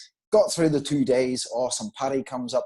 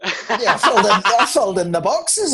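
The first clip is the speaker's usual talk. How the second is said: higher, louder, laughing as he defends himself; laughing protest; 5 semitones higher, 7 dB louder than usual. Real, not acted. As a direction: protest through your laughter. Caught out, laughing while protesting.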